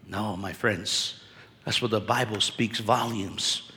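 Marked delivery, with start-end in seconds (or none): none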